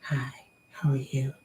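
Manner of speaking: soft, voiceless whisper; ASMR whisper. Shown as a demonstration. Woman showing what she likes in ASMR, whispered. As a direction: whisper it close, like an ASMR greeting. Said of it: "I just like the whispering".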